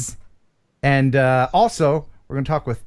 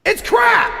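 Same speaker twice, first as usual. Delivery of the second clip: building to a much higher, louder yell on crap; shouted slogan; 4 dB louder than usual. Acted. Host signing off the sponsor plug, mock-shouted catchphrase. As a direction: bellow the catchphrase like an ad tagline. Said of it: "I made that up".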